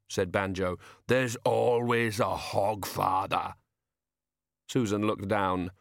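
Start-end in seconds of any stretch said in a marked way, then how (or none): 1.1-3.5 very slow, lumbering character voice; big simple man, stubbornly insistent; slow-witted giant. A narrator's reading.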